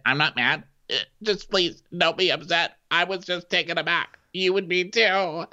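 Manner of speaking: squeaky mock impression of Craig pleading; mock high voice. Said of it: "And he said".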